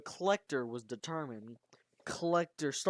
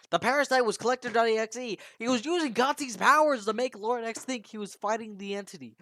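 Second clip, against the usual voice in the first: raised, loud, excited storytelling; excited reveal; 6 semitones higher, 8 dB louder, faster than usual. A narrator's reading. Storyteller revealing who the parasite really was, loud and excited. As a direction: blurt out the big twist, high and loud.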